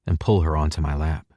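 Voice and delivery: ordinary delivery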